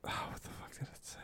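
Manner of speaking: whispered to herself. Real, not acted. Trying to recall a bumper sticker, whispered to herself. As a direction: mutter it under your breath, voiceless.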